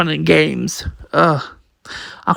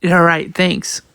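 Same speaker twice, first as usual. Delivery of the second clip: plain reading voice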